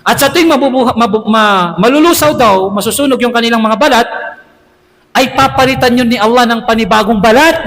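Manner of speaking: normal talking voice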